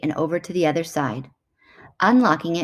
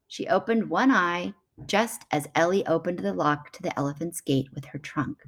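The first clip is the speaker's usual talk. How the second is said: plain reading voice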